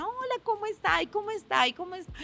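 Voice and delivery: high-pitched singsong hellos, repeated; mock dutiful greetings. Daughter-in-law mimics greeting every relative, mock high singsong. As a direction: parody a round of polite greetings up high.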